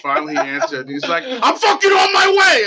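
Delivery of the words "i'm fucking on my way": high, drawn-out furious shout; loud retold outburst, stretched and high; losing his shit. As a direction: imitate an exploding friend yelling down the phone. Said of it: "lose his shit".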